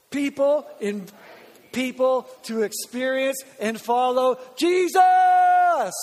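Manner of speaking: loud, slow, shouting the vision statement; with gusto. Real, not acted. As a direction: say it like you believe it, full voice. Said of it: "say it like you mean it".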